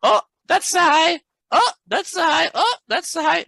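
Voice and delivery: high squeaky mock trader voice; naive trader. Trading coach mocking a newbie who calls every high, high squeaky voice. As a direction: mimic an overexcited newbie spotting a high. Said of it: "Never try to be".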